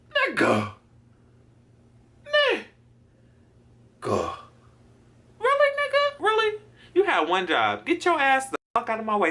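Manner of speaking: high-pitched exasperated scolding; re-enacted road rage, voice jumping high; acted outrage. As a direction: berate the other driver in squealing disbelief. Acted outrage at truck driver.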